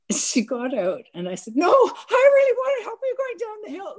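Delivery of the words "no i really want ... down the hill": much higher, frantic pleading voice; like a lunatic. Retold or imitated. Insisting to her elderly friend she needs help, high frantic pleading. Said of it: "like a lunatic".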